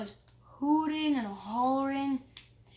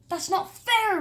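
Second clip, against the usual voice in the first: loud, high angry protest; yelled; 9 semitones higher, 7 dB louder, slower than usual. Acted. Mayor dragged away, loud high angry yell. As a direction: yell the unfairness. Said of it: "Mayor Hubble yelled at".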